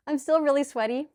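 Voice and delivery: sheepish laugh. Real, not acted. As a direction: laugh it off, a bit embarrassed. Laughs at herself.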